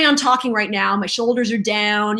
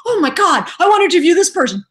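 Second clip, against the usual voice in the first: high, fast, louder gush; mock excitement; 7 semitones higher, 4 dB louder, faster than usual. Radio host retelling her wish for guests, excited. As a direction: rush it out in eager delight.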